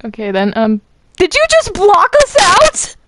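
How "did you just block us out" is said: high-pitched indignant scream; loud, piercing outburst at the others; screaming outrage. Acted. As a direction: shriek it in disbelief. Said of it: "Could you please stop screaming".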